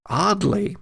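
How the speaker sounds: ordinary talk, nothing marked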